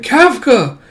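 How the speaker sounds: high, loud cry of a name; startled calling out. Acted. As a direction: call out on suddenly spotting a friend. Child spots friend, calls him.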